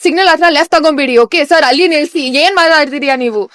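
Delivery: loud, rapid-fire bluffing in Kannada; mock yell back. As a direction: yell back as if you know the language. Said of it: "I would yell back".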